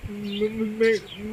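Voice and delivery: usual speaking voice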